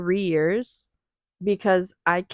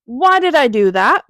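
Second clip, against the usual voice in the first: ordinary talk, nothing marked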